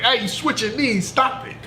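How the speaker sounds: high-pitched mock mom telling off; scolding mom. Mom catching her son switching knees, mock scolding. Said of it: "she's like".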